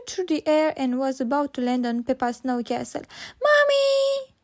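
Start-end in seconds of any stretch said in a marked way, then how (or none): 3.5-4.3 very high calling shout. A narrator's reading. Peppa shouting for Mommy's help, very high call. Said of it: "shouted Peppa".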